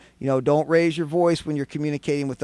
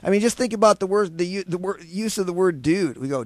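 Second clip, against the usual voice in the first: ordinary talk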